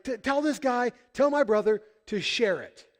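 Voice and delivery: high-pitched insistent complaint; mock aggrieved demand. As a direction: plead your case like a wronged brother. Aggrieved brother demanding his share.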